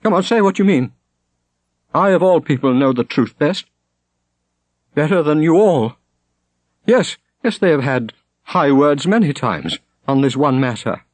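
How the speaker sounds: put-on high, fierce young woman's challenge; a grieving girl turned fierce; defiant daughter. A narrator's reading. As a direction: defend her man against the whole circle. Shonet keeps arguing.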